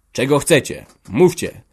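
fed up with waiting, call it out sharply; sharp, impatient. A narrator's reading. Father impatient at his children's silence, sharply. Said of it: "zawołał ostro, zniecierpliwiony milczeniem".

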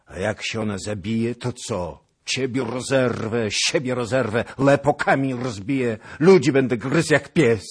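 raging threats. A narrator's reading. Cossack threatening to tear the witch apart. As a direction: threaten like a maddened beast.

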